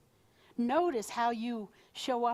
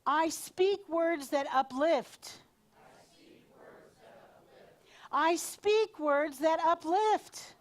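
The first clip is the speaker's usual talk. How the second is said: slow, higher, louder declaration; affirmation to repeat; 6 semitones higher, 5 dB louder, slower than usual. Shown as a demonstration. Leader says an affirmation for the group to echo, slow declaration. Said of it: "say this after me".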